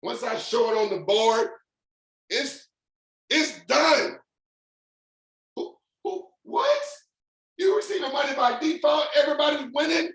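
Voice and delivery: high-pitched, excited pitch climbing to incredulous joy; fired up. Real, not acted. Selling the guys on money by default, fired up. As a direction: let the excitement push your voice right up. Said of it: "I'm just fired up".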